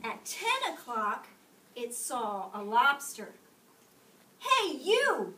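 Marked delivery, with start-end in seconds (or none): 4.4-5.3 loud, high, picking a fight; grouchy challenge. A narrator's reading. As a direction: bark it out like a tiny bully. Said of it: "said the grouchy ladybug".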